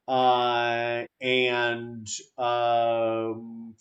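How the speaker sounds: normal talking voice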